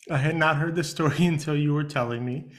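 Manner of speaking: laughing through the words; can barely speak for laughing. Real, not acted. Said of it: "tears falling out of my eyes".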